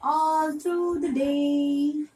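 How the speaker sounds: slow, drawn-out rhyme line; reading to a toddler. Shown as a demonstration. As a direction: stretch it out for little kids. Poem line read slowly.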